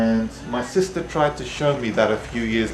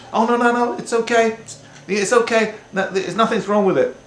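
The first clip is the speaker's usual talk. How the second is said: much higher, insistent reassuring voice; reassuring relative; 11 semitones higher than usual. Retold or imitated. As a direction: brush it off, it's perfectly fine. Relative insisting dropped pork fine.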